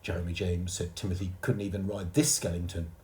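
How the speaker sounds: ordinary delivery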